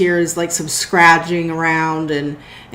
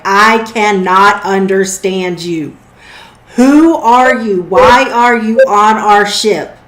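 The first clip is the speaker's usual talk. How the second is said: slow, emphatic demands through a door, building louder; exasperated in-character confrontation, slow and forceful; arguing angrily; 3 semitones higher, 5 dB louder, slower than usual. Acted. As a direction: demand answers, stretching each word and raising pitch and volume. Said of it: "you hear Ramsey start arguing".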